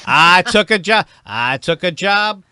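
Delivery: slow, loud deadpan punch; mock-dramatic delivery; slow comic punch. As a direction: land it slowly for laughs. Performing catchphrase bit.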